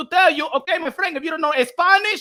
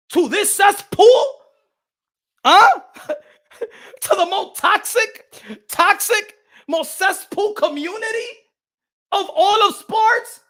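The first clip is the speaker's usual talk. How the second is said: scornful disbelief; 6 semitones higher, 3 dB louder, slower than usual. Real, not acted. Outraged mockery of community.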